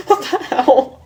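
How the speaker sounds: breaking up mid-reading; real laughter. Real, not acted. Cracks up at her own story.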